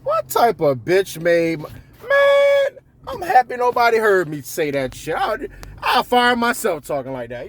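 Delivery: high, slow disbelief at yourself; self-scolding. Retold or imitated. New boss scolding himself after a bad talk. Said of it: "think about that shit and be like".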